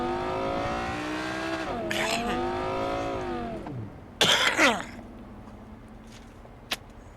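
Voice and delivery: long hacking smoker's cough. Real, not acted. Coughing fit mid-stream.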